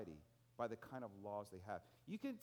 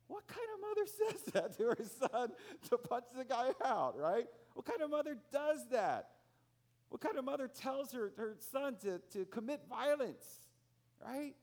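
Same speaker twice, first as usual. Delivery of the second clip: high, loud, laughing disbelief; incredulous outrage; 10 semitones higher, 7 dB louder than usual. Real, not acted. Preacher recalling the mother, high loud incredulous exclaim. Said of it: "indignant rhetorical outburst".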